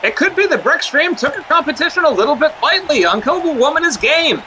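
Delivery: ringside play-by-play; wrestling commentator. Acted. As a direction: call the action like a wrestling broadcast.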